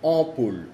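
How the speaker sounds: ampoule with a nasal first vowel; French ampoule, nasal; French demo word. Shown as a demonstration. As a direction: ampoule as o-poule. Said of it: "ampoule, que es foco".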